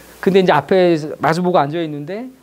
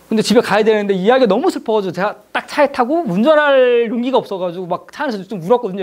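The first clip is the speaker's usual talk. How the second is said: usual speaking voice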